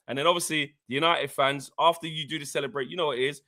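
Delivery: ordinary talk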